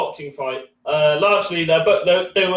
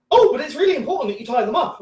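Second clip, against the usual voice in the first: put-on fussy old lady; 9 semitones higher, faster than usual. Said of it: "She goes".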